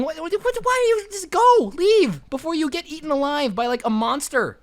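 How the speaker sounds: can't believe anyone would do this; exasperated disbelief. Real, not acted. Storyteller scolding the visitor for staying, exasperated.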